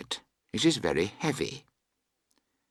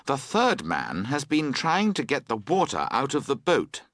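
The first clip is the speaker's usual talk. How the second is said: ordinary delivery, nothing marked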